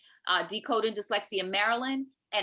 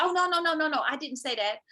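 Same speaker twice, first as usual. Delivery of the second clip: flustered backpedal; 7 semitones higher than usual. Retold or imitated. Psychologist retracting her slip to the mother, high flustered denial. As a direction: imitate a panicky official taking it back.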